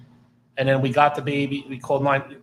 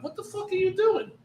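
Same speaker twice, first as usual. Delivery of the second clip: incredulous yell. Retold or imitated. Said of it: "I'm like".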